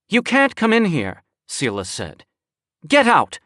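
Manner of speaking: high, sharp command to leave; angry order. Acted.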